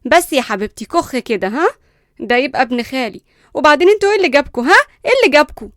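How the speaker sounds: loud, jealous scolding; irritated. A narrator's reading. Jealous cousin pushing her friend's hand away, irritated. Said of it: "ليندا بغيظ وهي بتبعد إيدها".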